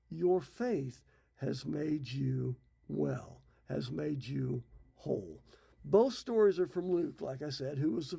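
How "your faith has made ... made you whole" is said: slow, lower, weighty pronouncement; quietly proclaimed healing; slow solemn blessing. Retold or imitated. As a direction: draw out each word like a benediction.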